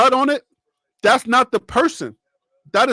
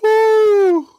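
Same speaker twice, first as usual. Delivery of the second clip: long, high, loud "woo"; celebratory whoop; 11 semitones higher, 5 dB louder than usual. Real, not acted. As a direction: let out a drawn-out cheer. Cheering the touchdown.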